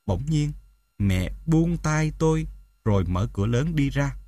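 ordinary delivery

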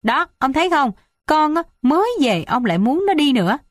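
high, aggrieved wife's voice; reproachful. A narrator's reading. Wife blaming husband for driving daughter away, reproachful. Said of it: "cặp mắt trách hờn".

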